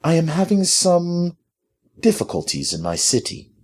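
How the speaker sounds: high start settling into polite restraint. A narrator's reading. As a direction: choose the words politely and carefully. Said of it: "keeping her tone polite".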